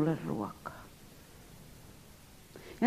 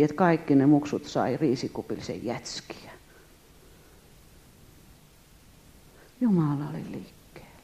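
whispered, softer wonder at the miracle; hushed awe; 5 semitones lower, 4 dB quieter than usual. Acted. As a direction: let it fall to a reverent hush.

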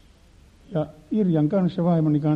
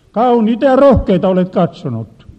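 louder accusing reply; God's retort; 5 semitones higher, 9 dB louder than usual. Retold or imitated. God answering the preacher's denial, louder and accusing. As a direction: catch him out sharply. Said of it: "Nyt Jumala näytti".

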